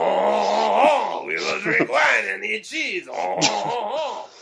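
French accent, mock Frenchman praising wine and cheese, shouted high; comic French accent. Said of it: "That's my French accent".

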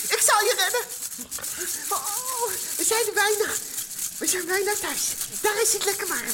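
put-on a tiny mouse reassuring the girl, squeaky and high. Mouse reassuring Meis on his bike, high squeaky voice. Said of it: "zei de muis".

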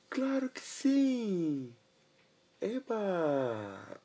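high, slow, cheering pony; excited pony. Acted. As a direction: squeal it out with joy. Pony answers happily.

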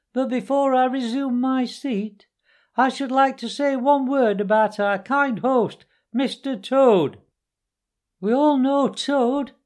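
high-pitched after-dinner speechmaker; high squeaky weasel. A narrator's reading. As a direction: make a squeaky speech to a banquet. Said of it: "began in a high, squeaky voice".

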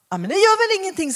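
preacher's eager reply to the buddhist; mock bright retort. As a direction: brush it off cheerfully. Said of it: "säger jag".

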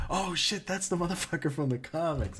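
high-pitched, pumped fan reaction; acted-out giddy amazement; re-enacted excitement. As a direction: blurt it like a thrilled comics fan. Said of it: "I was just so pumped".

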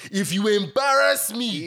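high, loud, slow Nigerian parent voice. Retold or imitated. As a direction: play the outraged Nigerian parent. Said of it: "like, a Nigerian parent saying".

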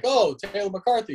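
imitating himself recognising a famous rep; surprised recognition. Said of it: "I'm like".

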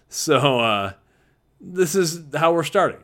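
normal talking voice

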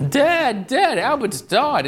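put-on high-pitched, drawn-out child's wail; acted distraught kid calling for Dad; upset little boy. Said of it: "he was very upset".

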